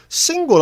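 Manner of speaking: "Single" thrown out high and loud, drawn out in mockery; mocking "Single". Checkout guy mocking the customer with a high, loud "Single".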